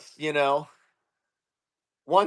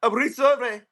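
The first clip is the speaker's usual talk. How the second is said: put-on higher-pitched reply in an Indian accent; mock Indian accent; 6 semitones higher than usual. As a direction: imitate an Indian agent repeating himself. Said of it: "he's like".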